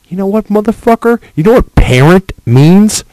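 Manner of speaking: loud, high, fast angry yelling; screaming at bad parents; furious shout. Real, not acted. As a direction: blast the parents. Ranting at parents.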